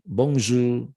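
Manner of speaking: "bonjour" drawn out slowly as "bong-zhoo"; slow careful bonjour. Shown as a demonstration. Teacher models the greeting slowly for the class. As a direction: stretch "bonjour" for learners to copy. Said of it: "bonjour artinya hello".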